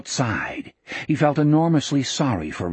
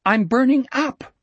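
high, plaintive complaint; higher-pitched, aggrieved boy; protesting; 11 semitones higher than usual. Acted. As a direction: insist on it in a whiny rise. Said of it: "Richard protested".

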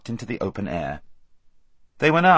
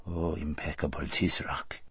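lower, much softer obsequious address; flattering vizier; 6 semitones lower, 11 dB quieter than usual. A narrator's reading. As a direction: flatter the ruler lavishly.

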